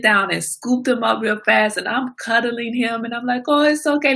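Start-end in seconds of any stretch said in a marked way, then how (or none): 3.5-4.2 high, cooing reassurance; baby-soothing. Retold or imitated. Owner comforting her hurt puppy like a baby, high cooing reassurance. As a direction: soothe him like a little baby. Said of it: "like he's a little baby".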